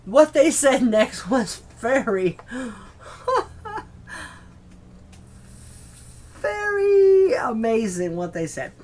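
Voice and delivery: words broken up by real laughter; laughing through words. Real, not acted. Telling listeners about the guests' remark, laughing. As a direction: laugh your way through the line.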